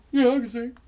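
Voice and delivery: Bob's voice. Retold or imitated. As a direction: pop it up high like Bob. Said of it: "That's the way he talked".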